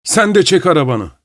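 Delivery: high, bossy bully voice; swaggering masked drunk barking orders; rude masked man. A narrator's reading. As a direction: order him out with contempt.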